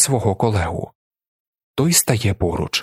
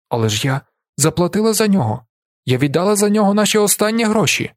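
higher-pitched pleading protest; desperate protest; 9 semitones higher, faster than usual. A narrator's reading. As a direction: protest the loss, pitch climbing. Woman protests confiscation of food. (розпачливий протест, вищим голосом)

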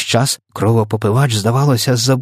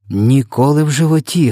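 higher-pitched, heated denial; fervent assurance; 5 semitones higher, 4 dB louder than usual. A narrator's reading. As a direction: swear it hotly, pitch lifted. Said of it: "гаряче запевнив".

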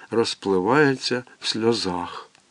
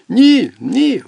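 high and louder, stubborn; stubborn refusal; 9 semitones higher, 6 dB louder than usual. Acted. Boy resisting mother, stubborn loud no. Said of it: "впирається він".

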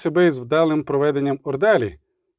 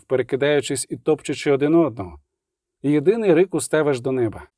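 ordinary delivery

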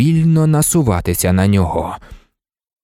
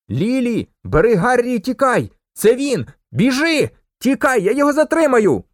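higher, urgent panicked orders; panicked shout; 11 semitones higher than usual. A narrator's reading. Harry's father shouting for Lily to flee, panicked. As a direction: shout in panic to save them. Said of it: "що панічно кричав".

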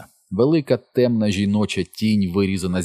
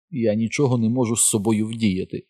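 ordinary delivery, nothing marked

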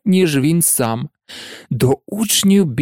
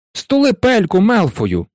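higher-pitched schoolgirl retort; mild angry cry; 6 semitones higher than usual. A narrator's reading. Parvati telling Malfoy to shut up, mild angry cry. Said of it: "крикнула Парваті Патіл".

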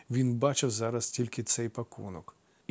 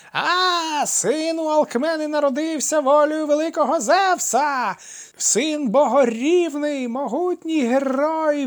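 very high, loud, slow frenzied screech; hysterical shrieking; 8 dB louder, slower than usual. A narrator's reading. Mad old woman shrieks the hero's birth across the yard. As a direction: shriek in wild triumph. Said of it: "істерично верещачи".

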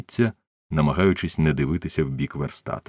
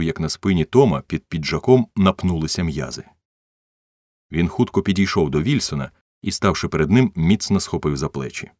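ordinary delivery, nothing marked

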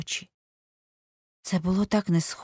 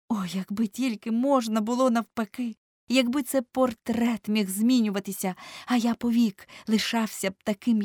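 higher-pitched, impassioned jealous lament; anguished Dorian; 5 semitones higher than usual. Acted. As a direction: lament it like a wronged, despairing youth. (розпачливий, заздрісний плач-монолог юнака)

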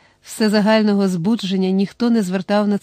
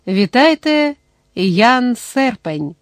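stage announcement; 3 semitones higher, much slower than usual. A narrator's reading. Host presents Jan to the hall, announcer's call. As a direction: announce the guest, drawing out his name. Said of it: "повідомив коротко і без звичних для себе словесних декорацій".

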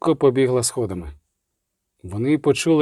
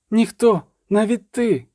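високий, затятий вигук дівчинки; кинь високим голосом, навідріз; дівочий вигук; 8 semitones higher, slower than usual. A narrator's reading. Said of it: "крикнула вона". (упертий крик протесту)

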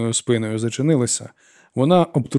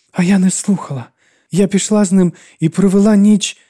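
high, loud outburst; distraught, raised-voice admission; agitated confession; 9 semitones higher, 6 dB louder than usual. A narrator's reading. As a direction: blurt out the confession, upset. Lora's agitated confession cuts in.

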